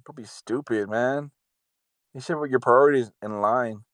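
ordinary delivery

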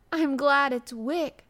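high, loud, drawn-out gladness; eager outcry. A narrator's reading. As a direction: cry it out with delight. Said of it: "she cried out in her whisper".

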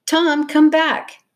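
high, drawn-out call. A narrator's reading. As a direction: call someone back from a distance. Calling after running Tom.

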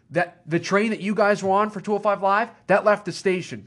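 retold wrestling promo. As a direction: lay down the challenge like a promo retold. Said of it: "the most real promo ever".

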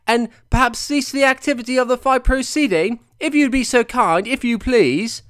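high, overly polite English gent; posh genteel trainer. Retold or imitated. Trainer politely begging the referee to stop it, mock-posh. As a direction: ham up a prim, courteous old Englishman. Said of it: "That's probably not what Brendan Ingle sounds like at all".

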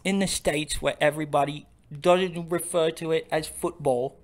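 lampooning Americans as an Englishman; mock English accent. Mocking Americans in a hammed-up English accent. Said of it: "our terrible English accents".

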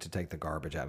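ordinary talk

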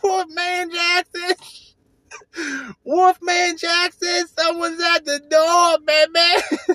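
high, slow, hammed-up radio DJ howl; Wolfman Jack impression. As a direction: stretch it out like a wild late-night DJ. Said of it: "Wolfman Jack sketch".

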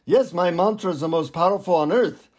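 put-on guru speaking in an Indian accent. Guru proclaiming his mantra to a seeker, Indian accent. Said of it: "in an Indian accent, he says".

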